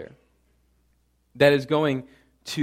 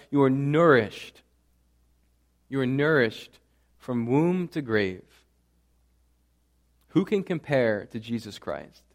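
ordinary delivery

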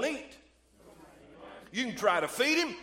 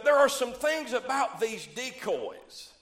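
normal talking voice

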